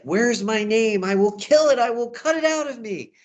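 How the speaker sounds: high, loud theatrical outburst; hammed-up distraught threat; frantic Romeo. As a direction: rave in mock desperation. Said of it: "pulls out a knife at one point and says".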